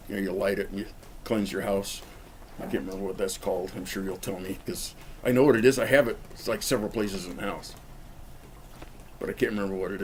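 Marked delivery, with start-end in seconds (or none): none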